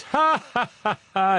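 high-pitched, loud "ha, ha"; mock read-out laugh. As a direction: read typed laughter aloud as spaced high ha's. Reading out a listener's written laugh.

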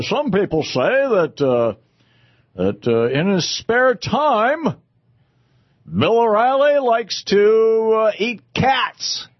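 slow, high parody of a TV pundit; hammed-up, halting newsman parody; mock pundit insinuation. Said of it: "this is the official catchphrase".

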